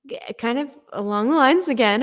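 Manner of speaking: ordinary delivery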